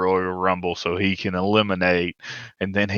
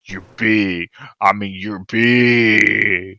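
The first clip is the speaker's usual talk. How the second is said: slow, louder mock wrestler repeating the word; Brock Lesnar voice; 5 dB louder, much slower than usual. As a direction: stretch each "big" like a stunned brute. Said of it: "that was when he says".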